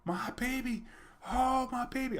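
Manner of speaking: high wailing mother, lightly done; imitated hysterical crying. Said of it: "she was hysteric".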